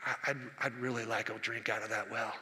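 murmured longing; a low, quiet wish under the breath; under his breath. Acted. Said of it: "under his breath".

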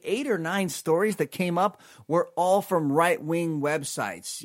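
ordinary talk

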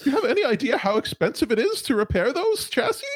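high, fast, prim office voice; fussy high-pitched receptionist; high corporate robot voice. Acted.

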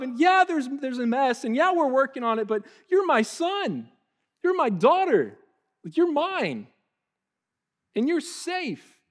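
high, loud, overjoyed gasping delight; delighted parent. Pretend. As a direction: gush over your child with joy.

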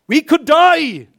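high, loud, slow proclamation; dramatic preaching. Acted. Preacher telling of the lepers, high slow loud. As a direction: let it ring out slowly.

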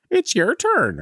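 very high squawky bird voice; creepy talking bird. Pretend. Host imagining the bird repeating the attic phrase, high bird voice. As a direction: voice a possessed budgie, way up high. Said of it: "the bird was gonna be saying".

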